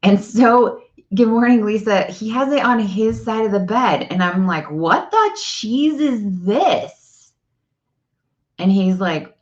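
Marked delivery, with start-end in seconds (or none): 4.7-7.3 drawn-out, higher-pitched disbelief; mock indignation. Wife confronting husband over the electric blanket, mock indignation. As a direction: draw out a high, baffled complaint.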